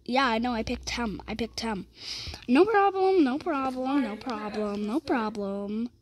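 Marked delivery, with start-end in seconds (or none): 2.5-3.2 "No problem" pitched high, then falling; breezy "no problem". Real, not acted. Repeating reply, first take. 4.9-5.9 "No problem" low, soft and drawn out; trailing "no problem". Real, not acted. Repeating reply, last take.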